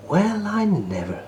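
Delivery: amazed. Acted. Percy amazed by secret passage.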